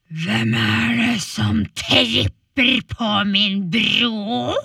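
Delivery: loud, slow, booming troll. Acted. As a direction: boom it from under the bridge. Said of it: "sa trollet".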